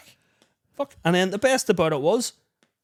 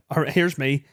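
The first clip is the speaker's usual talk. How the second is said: usual speaking voice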